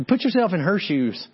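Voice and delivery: ordinary delivery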